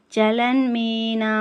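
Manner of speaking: చలన్మీనా very slow with the final నా drawn out; long drawn na. Shown as a demonstration. Teacher drawing out the last syllable of చలన్మీనా. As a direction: stretch the నా in చలన్మీనా. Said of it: "చేప పిల్ల కదిలినంత వేగంగా స్పష్టత ఉండాలి".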